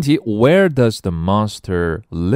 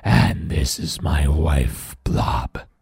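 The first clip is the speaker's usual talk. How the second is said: slow, rasping monster voice; drawn-out growly monster; Grit; 3 semitones lower, much slower than usual. Acted. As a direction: rasp it slowly like a big creature. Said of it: "said the monster".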